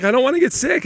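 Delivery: whiny daughter. Retold or imitated. Dad imitates his daughter complaining about getting sick, high whine. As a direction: whine like a bored teen. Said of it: "she's just like".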